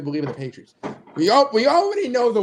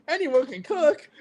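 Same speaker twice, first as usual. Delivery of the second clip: Ratatouille quote; 8 semitones higher, slower than usual. Retold or imitated.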